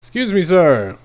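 loud, high polite call to get his attention; loud courteous hail; calling out politely. Acted. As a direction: raise your voice to reach the old man. Calling to the old man.

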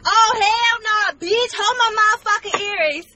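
put-on high-school girl squaring up; ready to fight. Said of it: "I used to be like".

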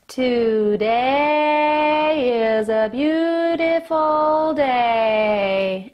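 slow, sustained, drawn-out speech; slow motion. Shown as a demonstration. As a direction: stretch each word out slowly. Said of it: "and then do the same, a little bit more sustained".

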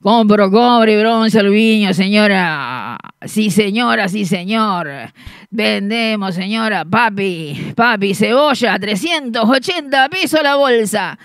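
loud, low hawker's chant; street vendor call. Retold or imitated. Street vendor calling out his wares. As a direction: bellow the wares like a street buyer. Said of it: "se empieza a escuchar de lejos".

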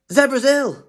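high, loud, panicky announcement; alarmed cry. Acted. Animals crying out about sick Zebra, alarmed.

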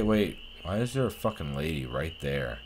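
ordinary talk, nothing marked

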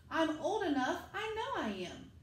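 put-on a small boy insisting he's old enough; little Sam insisting.

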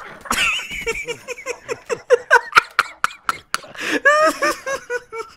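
loud, very high howling laughter. Real, not acted. Cracking up over the invite joke, high howling laugh. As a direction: howl with laughter.

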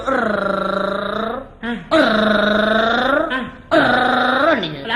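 long low rolled "urrr"; low, sustained rolling rumble; imitated noise. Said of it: "ஏதோ சத்தம் கேட்டுது".

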